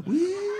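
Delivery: very high, loud drawn-out "wiii"; mock awed squeal. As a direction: let out a comic squeal of amazement. Mock awe at dressed-up dog. (pekikan "wih" melengking pura-pura kagum)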